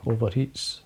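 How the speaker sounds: ordinary talk, nothing marked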